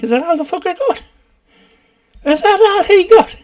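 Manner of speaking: very high-pitched scoffing question; mock incredulous. Viewer unimpressed by dad's slapping, mock incredulous squeal. Mocking the dad's mild violence.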